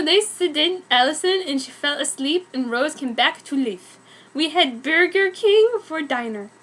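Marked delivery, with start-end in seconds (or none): none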